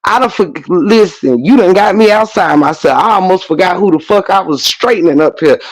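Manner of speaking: ordinary talk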